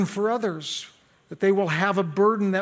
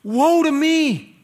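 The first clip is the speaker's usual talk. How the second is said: crying out; 9 semitones higher, 8 dB louder than usual. Acted. Preacher acting Isaiah before the Lord, crying out. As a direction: cry it out like a stricken prophet. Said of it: "he cried out".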